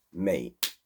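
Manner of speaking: ordinary talk, nothing marked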